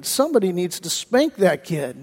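low, soft private thought; thought to oneself. Retold or imitated. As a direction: say it under your breath, like thinking. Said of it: "you're thinking".

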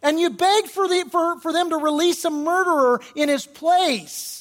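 loud, high, impassioned accusation; Peter accusing. Acted. Preacher as apostle blaming the crowd for Jesus' death, fiercely accusing. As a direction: drive the charge home at full force. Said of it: "Peter says".

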